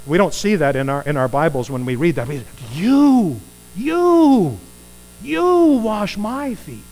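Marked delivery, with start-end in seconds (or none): 2.7-6.8 you rising high and loud, drawn out slow; emphatic disbelief. Acted. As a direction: hammer each you higher in disbelief. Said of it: "and that's emphatic".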